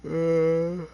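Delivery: talking through a big, loud yawn. Real, not acted. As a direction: stretch the words out high as a real yawn takes over. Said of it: "Sorry about the yawning".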